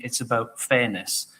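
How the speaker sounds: ordinary talk, nothing marked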